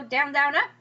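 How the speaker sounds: "down, down" light, "up" lifted; anapestic stress. Shown as a demonstration. Teacher coaxing students to hear contradict, stress on "up". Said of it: "Don't you go".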